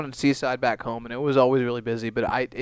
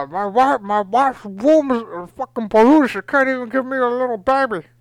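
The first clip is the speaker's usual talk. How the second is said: high, loud, slurred impression; slurring Scarface; 9 semitones higher, 5 dB louder than usual. Comedian plays stroke-afflicted Scarface griping about his wife, loud slurred wail. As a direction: drag the words out through a drooping mouth. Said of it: "really good impression of, uh, Scarface if he had that, uh, ailment".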